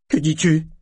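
stifled cry. A narrator's reading. Serwin jumps up at Malta's news, stifled cry. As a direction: let out a startled cry. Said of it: "en laissant échapper un cri étouffé".

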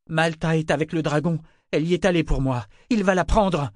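high-pitched, rising urgency; worked-up, anxious insistence; urgent pleading. A narrator's reading. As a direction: plead with mounting alarm. Drunk man pleads urgently with mother.

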